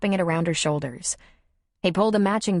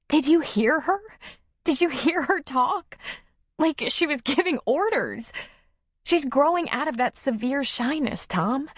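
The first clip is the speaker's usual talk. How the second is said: high, eager woman's voice full of feeling; emotional Nora; 10 semitones higher than usual. Acted. Nora telling Tom about the child talking, overcome. Character dialogue.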